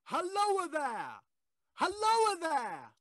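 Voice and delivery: long, high hail down the cutting, drawn out slowly; calling out. Acted. Ghostly voice hailing the signalman from above, a drawn-out high call. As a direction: call out to the man below, slow and high.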